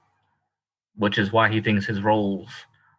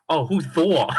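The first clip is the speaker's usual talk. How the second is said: higher-pitched mock mom asking; imitating mom; 6 semitones higher, slower than usual. Son retells mom asking about a superhero, mimicked voice. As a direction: mimic mom's puzzled question. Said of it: "And she was like".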